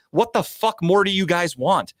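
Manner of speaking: fed-up outburst at the fans; exasperated rant. Real, not acted. Ranting at Browns fans on the podcast, exasperated and slow.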